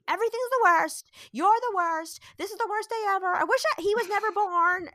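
high, loud mock tantrum; dramatic small child. Mom mimics her three-year-old copying big brother, melodramatic. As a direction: pile up the griping like an overdramatic kid. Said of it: "my three-year-old talks like that".

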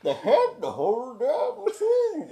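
high, slow Goofy-like Quint voice; New England accent. Retold or imitated. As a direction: drawl it out as a cartoonish Quint. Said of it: "tried to still do the New England accent".

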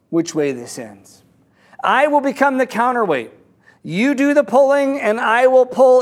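lightly acted firm instructions, phrase by phrase; firm call down. Man on bridge offers to be counterweight, firm call down.

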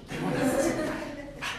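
fast, breathless whispered laugh through the words; stunned laughing whisper; laughing disbelief. Retold or imitated. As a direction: giggle it out in disbelief.

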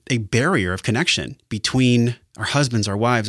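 ordinary delivery

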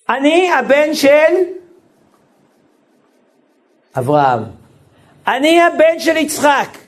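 slow, earnest prayer voice; a heartfelt prayer spoken to God; prayerful declaration. Acted. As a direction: declare the faith slowly, word by word.

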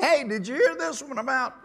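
joking at a funeral; laughing joke opener. Retold or imitated. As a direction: tease them with a joke at a funeral. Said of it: "I'll tell a joke".

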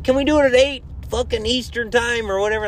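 mock podcast host. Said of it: "They're like".

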